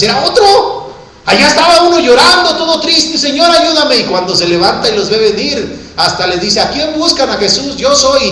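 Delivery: high, impassioned storytelling sermon; prédica exaltada. Acted. Relato predicado con fervor. (relato predicado con voz alta y exaltada)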